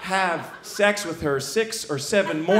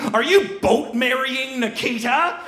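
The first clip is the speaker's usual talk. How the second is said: higher, slow scandalised disbelief; incredulous question; 6 semitones higher, 4 dB louder than usual. Acted. Shipmates ask James about boat marrying Nikita, incredulous. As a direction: draw out each word in mock shock.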